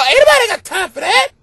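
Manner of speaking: high, loud viral lady voice; ringtone impression. Wife's ringtone plays the viral lady, high and loud. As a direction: sing it out like the ringtone clip. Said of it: "Somebody call".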